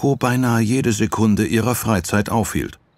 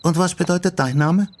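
put-on high girlish question; curious young girl; 12 semitones higher than usual. Yoko asks her new friend what her name means, curious. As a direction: ask brightly in a much higher voice.

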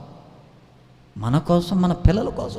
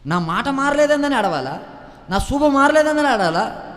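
high, loud pulpit cry; rising sermon fervour, loud and high; fiery preaching; 8 semitones higher, 3 dB louder than usual. Acted. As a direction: preach it hard to the congregation. Preaching at full force.

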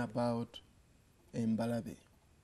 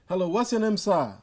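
raised, higher voice over a bad line; to someone hard of hearing; 6 semitones higher, 8 dB louder than usual. Real, not acted. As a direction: call out clearly to a distant caller. Caller hard to hear.

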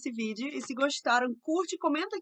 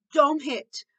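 loud, higher sharp order; scolding command; 4 semitones higher, 8 dB louder than usual. Shown as a demonstration. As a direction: order a child firmly and loudly to stop. Models scolding to avoid. (bronca firme e alta)

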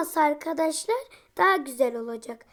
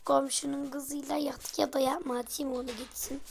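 usual speaking voice

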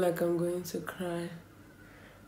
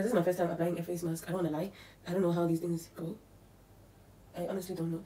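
usual speaking voice

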